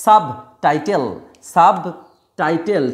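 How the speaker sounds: সাবটাইটেল with the b of সাব sounded; B pronounced. Shown as a demonstration. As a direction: keep an audible b before the t in সাবটাইটেল. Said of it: "তখন কিন্তু তার pronunciation হবে".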